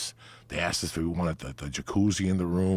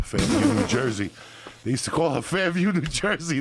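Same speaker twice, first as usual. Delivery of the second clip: punchline; 10 semitones higher, 5 dB louder than usual. Real, not acted. Delivering the nickname punchline.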